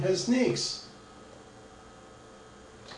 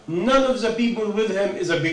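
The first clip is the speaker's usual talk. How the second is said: usual speaking voice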